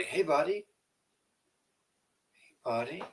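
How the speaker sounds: high, soft call to the dog. Real, not acted. Owner softly calling his dog Buddy, high coaxing call. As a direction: sing the name up to coax him over.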